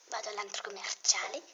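usual speaking voice

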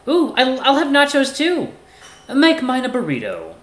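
high, loud, eager character voice; excitable Axel. Acted.

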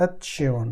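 এতশিয়ন said softly, ending in a hushed শিয়ন; softened demo word. Shown as a demonstration. Teacher showing learners the German ending, soft careful demo. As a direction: model এতশিয়ন quietly for the class. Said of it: "যখন আপনি উচ্চারণ শিয়ন".

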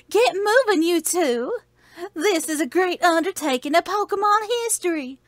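high, hammed-up Southern belle; drag queen Southern belle. Professor sends two trainers off, high drag queen voice. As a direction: send them off as a theatrical drag queen. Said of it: "Professor Oak's a drag queen".

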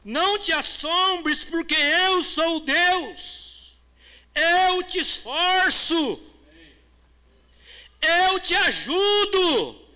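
high, loud and very slow, every word weighed; thundering promise, word by word; booming scripture. Acted. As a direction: proclaim it slowly like God speaking. Preacher reads God's promise.

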